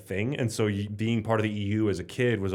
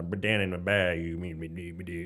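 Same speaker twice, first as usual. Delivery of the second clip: Bay accent impression. Showing off how Americans in the bay talk, light accent imitation. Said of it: "you're like".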